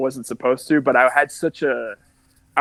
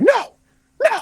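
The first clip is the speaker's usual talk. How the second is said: high, loud, alarmed mother's no; overprotective mother's no; 5 dB louder than usual. Retold or imitated. Overprotective Italian mother refusing her son's boxing, high loud no. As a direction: shriek it like a protective mom forbidding boxing. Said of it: "Like, overprotective Italian mother".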